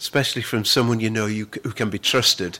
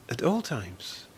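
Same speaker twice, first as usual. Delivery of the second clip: soft echo; 11 dB quieter, slower than usual. Shown as a demonstration. Quiet echo of the phrase.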